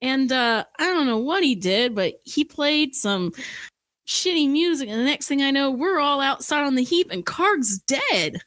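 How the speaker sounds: orc telling his tale. Acted. Orc reporting a death to Carver, agitated. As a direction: recount the bad news in character.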